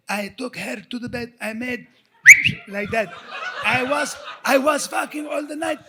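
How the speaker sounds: imitating a Middle Eastern accent, bragging, laughing; friend's accent. Mocking his friend Tawfiq's bragging in a Middle Eastern accent. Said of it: "he would go".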